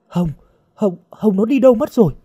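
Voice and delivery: high, rushed stutter; panicked stammer. A narrator's reading. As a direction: stammer the name, then blurt it out fast. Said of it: "Tuyết lắp bắp". (lắp bắp hoảng hốt)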